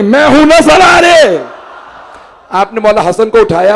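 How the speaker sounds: high, loud, ringing pulpit delivery; preaching at full force. Acted. Preacher telling the gathering the hadith, preaching at full force. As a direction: cry the story out to the gathering.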